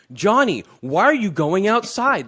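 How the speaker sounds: hammed-up sitcom actor calling a name; TV dialogue line. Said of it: "the way they write the shows".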